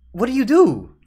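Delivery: high, loud, baffled question; exasperated outburst. Real, not acted. Viewer with no answer to the shot, exasperated outburst. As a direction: throw it out in helpless disbelief. Exasperated, then calmer repeat.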